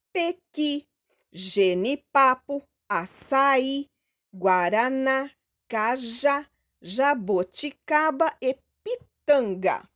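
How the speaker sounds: each word from "pequi" to "pitanga" broken into measured syllables; syllable-by-syllable reading. Shown as a demonstration. Teacher reads the list to children, "jaboticaba" and the rest split into syllables. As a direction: read "genipapo" as ge-ni-pa-po, likewise every word. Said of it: "Leia estas palavras separadas em sílabas".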